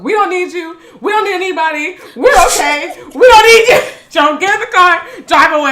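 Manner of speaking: high, loud frantic yelling; imitating frantic sister. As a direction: yell it up high, waving the cops off. Linda yelling at the cops.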